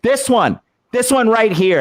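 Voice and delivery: high-pitched, emphatic finger-pointing; single out the culprit, pitch jumping up; retold indignant accusation.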